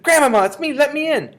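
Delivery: put-on high, urgent child's yell; very loud, high-pitched pleading call; full shout. A narrator's reading. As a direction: shout to be let in. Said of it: "I shouted as loud as I possibly could".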